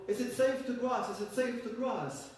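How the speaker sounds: high calling shout; calling over. Acted. Schwabians calling over to the head, high and calling. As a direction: call across the river, high. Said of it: "they called over".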